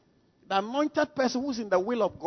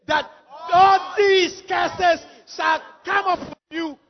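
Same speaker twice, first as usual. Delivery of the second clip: slow, high, shouted scripture reading; loud group reading; 8 semitones higher, 7 dB louder, slower than usual. Shown as a demonstration. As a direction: read it out with strength, top of your lungs. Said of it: "Read it with strength".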